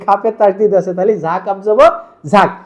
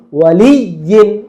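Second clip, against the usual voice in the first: ওয়ালিয়িন slowly, leaning hard on লিয়ি; ওয়ালিয়িন with a weighted middle; force on doubled ya; slower than usual. Shown as a demonstration. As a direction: press and hold the doubled y in ওয়ালিয়িন. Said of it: "একটা জোল দিতে হয়".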